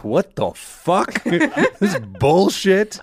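cracking up at the absurd crepe; amused outrage at a Mickey Mouse crepe; laughing disbelief. Real, not acted.

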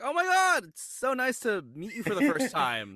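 high, loud, gushing first-meeting voice; gushing mock greeting. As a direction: overdo the delight of meeting someone new.

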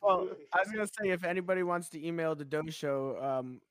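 a touch of a big promo announcer. Acted. Host pitching the show to listeners in a mild announcer voice. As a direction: pitch it like a radio call-out.

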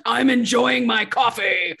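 slow, drawn-out imitation of yelling; hammed-up indignant holler, held back in volume; mock screaming. Walter refuses the server's request to leave the diner, mock screaming. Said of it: "he starts screaming, of course".

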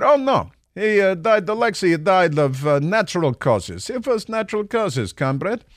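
high, put-on mocking Russian voice; sarcastic fake denial voice; mock Russian official. Mocking Ukrainian intelligence voicing Russian denial.

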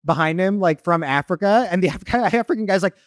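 ordinary delivery

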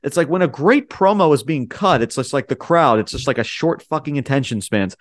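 ordinary talk

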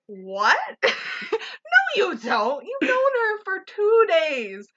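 laugh-cry of disbelief, high and slow. Real, not acted. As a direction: squeal through laughter. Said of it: "I was like".

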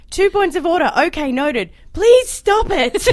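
high, loud exasperated plea; weary speaker of the house. Retold or imitated. Host imitating the Speaker scolding members, hammed-up exasperation. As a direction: beg them to stop like a tired referee.

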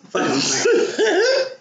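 high, slow, drawn-out mock excitement; mock eager vow. Telling a friend how she wanted him, drawn-out high excitement. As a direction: stretch out tonight, up high. Retelling her own eager thought.

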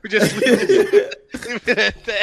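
laughing. Real, not acted. Laughing at the taunt.

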